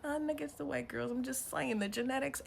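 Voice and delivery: ordinary talk, nothing marked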